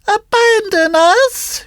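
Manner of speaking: very high, slow cat echo. Acted. Cat repeats the fox's plea, high echoing voice. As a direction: pipe the echo in a high, drawn-out voice. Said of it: "repeated the cat".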